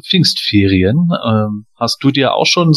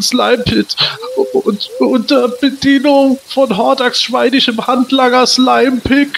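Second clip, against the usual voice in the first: high, nervous reading aloud; frightened reading; 9 semitones higher than usual. Acted. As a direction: read it scared stiff. Victim forced to read aloud.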